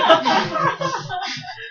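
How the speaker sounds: shrill burst of laughter at own joke; high giggle; high squealing laugh. Real, not acted.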